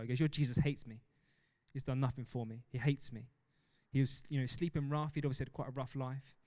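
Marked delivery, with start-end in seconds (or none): none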